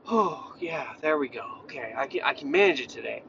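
low, soft acted sigh of relief; calmed down. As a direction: settle down quietly, as if the stress has drained away. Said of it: "Calm down a little bit?".

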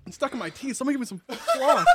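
ordinary delivery

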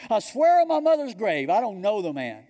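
very loud, high-pitched sworn denial; emphatic oath. Acted. Peter swears an oath as people might today, loudly emphatic. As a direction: cry out the oath, pitch falling by the end. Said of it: "That's how emphatic he's being now".

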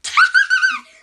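very high, loud shriek of laughter. Real, not acted. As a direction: scream with laughter. Reacts to joke.

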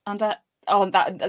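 ordinary delivery

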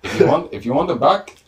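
hammed-up Irish warlord voice; Irish turtle warlord. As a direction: deliver a comic ransom demand in character. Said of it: "give us an Irish turtle warlord".

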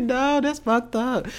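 high, drawn-out mock objection; dismayed protest. Protesting to the DMV man about removing the do-rag, dismayed. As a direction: re-enact a wailed, slow complaint. Acts out her protest to him.

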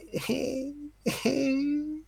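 dad hamming up a Joker laugh; mock Joker laugh. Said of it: "Listen to his laugh".

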